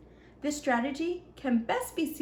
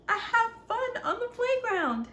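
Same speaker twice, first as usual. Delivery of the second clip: much higher, gleeful exclamation; 11 semitones higher than usual. Acted. Ninja telling young listeners about a good day, gleeful. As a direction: cheer it out in a high, happy voice. Happy ending of character's list.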